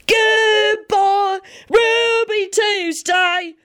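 high, slow bellowed goodbye; yelling. Acted. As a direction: belt it out across the tent. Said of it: "Sirius yelled at the top of his lungs".

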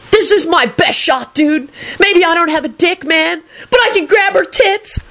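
high, frantic whine, climbing; hysterical howl. A narrator's reading. Kenny grabbing for Rosie in the dark, hysterical. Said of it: "Kenny howled hysterically".

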